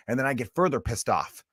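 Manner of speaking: usual speaking voice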